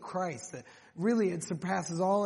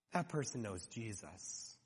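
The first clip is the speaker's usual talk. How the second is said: hushed aside; 9 semitones lower, 12 dB quieter than usual. Retold or imitated. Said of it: "you stand back and you say".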